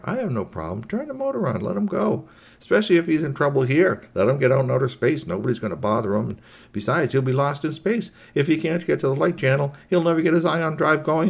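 lightly voiced easygoing robot waving the kid off; a hint of a dismissive robot character; lightly voiced Rabble Rob. Robot arguing to let the kid go, lightly voiced, dismissive. Rabble Rob's speech in the story.

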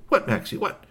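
high-pitched what-is-it to dog; high, puzzled dog owner; questioning owner. Acted. Shui Huang questions the barking dog.

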